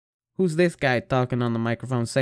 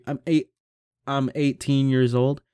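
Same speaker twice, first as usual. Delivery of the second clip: ordinary talk, nothing marked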